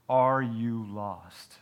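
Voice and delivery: drawn-out, solemn question; slow earnest question. Acted. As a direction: ask it slowly and gravely.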